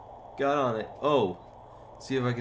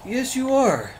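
teasing retort; 10 semitones higher, 6 dB louder than usual. Real, not acted. Teasing brother about being a butt.

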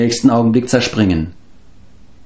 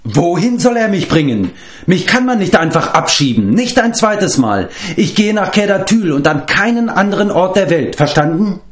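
put-on higher, indignant girl protesting; indignant outcry; 11 semitones higher than usual. A narrator's reading. Eilonvi protests being sent home, indignant and higher. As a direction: refuse to be sent away, indignant. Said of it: "stieß Eilonvi einen Schrei der Entrüstung aus".

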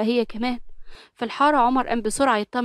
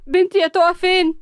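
tearful wail, much higher; a frantic mother crying for her child; 8 semitones higher, 4 dB louder than usual. A narrator's reading. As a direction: sob out the question for her daughter. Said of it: "وكانت بتعيط".

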